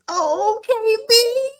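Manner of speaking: imitating his dramatic loud howl; retold anguished yell. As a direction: wail it like the jilted groom. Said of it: "And then he yells out this".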